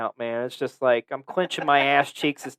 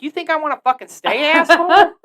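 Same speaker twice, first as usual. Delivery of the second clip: higher and louder sarcastic snap, laughing; retold angry retort; 13 semitones higher, 7 dB louder than usual. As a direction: throw it back at the waiter, fed up. Retort to the waiter.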